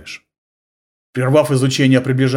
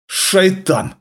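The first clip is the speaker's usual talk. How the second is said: cursing; 8 semitones higher than usual. Acted. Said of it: "выругался араб".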